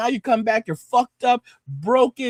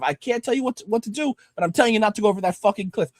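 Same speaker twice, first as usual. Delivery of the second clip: ordinary delivery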